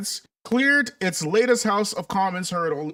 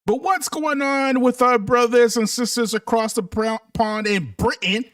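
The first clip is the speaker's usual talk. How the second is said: ordinary delivery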